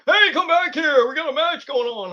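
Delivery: mock a little guy yelling after someone; mocking Marko Stunt. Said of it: "He's like".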